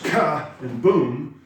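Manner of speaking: "ka and boom" with KA and BOOM both punched loud; drawn-out "ka and boom", loud on both beats; equal heavy stresses. Shown as a demonstration. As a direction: hit "ka" and "boom" as two separate slow blows. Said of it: "your group practices".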